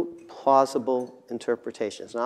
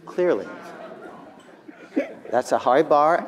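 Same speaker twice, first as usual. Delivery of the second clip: normal talking voice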